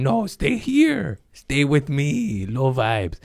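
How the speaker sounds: little crab in the pot pulling others back; clingy crab voice. Retold or imitated. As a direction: beg them to stay down with you. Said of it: "I was a little crab in the pot going".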